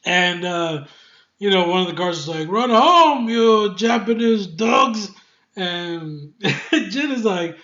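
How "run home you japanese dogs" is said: guard's taunt. Retold or imitated. Guard taunts Jin in battle.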